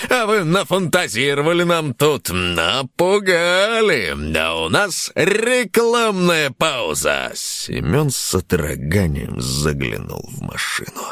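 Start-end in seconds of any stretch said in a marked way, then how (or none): 0.1-7.3 game show host. Acted. Host voice continues.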